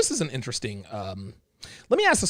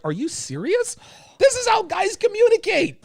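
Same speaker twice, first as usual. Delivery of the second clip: mock disbelief rising much higher; scoffing "are you serious", pitched way up; incredulous. Host mocking the advice columnist in high disbelief. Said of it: "and I'm like".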